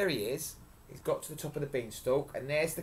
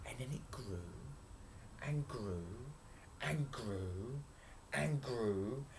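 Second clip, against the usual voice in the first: hushed storytelling; 9 dB quieter, slower than usual. A narrator's reading. Storyteller building the bean sprout's growth, hushed. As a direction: hold it right down before a big burst.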